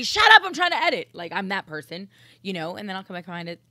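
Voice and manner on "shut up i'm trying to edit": much higher, much louder outburst; snapped shout. Retold or imitated. Host re-enacting telling somebody off while editing, snapped shout. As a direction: yell it at someone interrupting your work. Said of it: "I might tell somebody".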